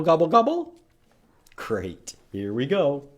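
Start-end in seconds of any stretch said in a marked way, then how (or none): 0.0-0.7 high gobbling prompt; turkey gobble. Shown as a demonstration. Teacher coaxing kids to gobble, playful high gobble. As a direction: invite the kids to gobble along. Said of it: "Can you go gobble".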